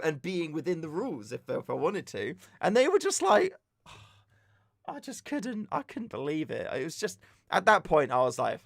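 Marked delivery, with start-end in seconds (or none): 4.9-5.7 sighing, soft and high; exasperated sigh. Real, not acted. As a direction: sigh in disbelief. Disbelief sigh at marshals.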